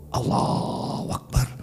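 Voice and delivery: very soft, drawn-out "Allahu Akbar"; slow, whispery prayer call; hushed prayer takbir. Acted. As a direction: breathe out the takbir slowly, almost voiceless.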